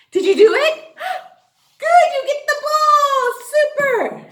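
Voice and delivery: high, loud cheering; excited praise. Real, not acted. Teacher praising kids for the right sound, high and loud. Teacher celebrating a correct answer.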